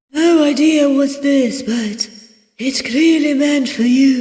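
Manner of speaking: high innkeeper character voice; puzzled innkeeper. Acted. Voices innkeeper Barrow in a story.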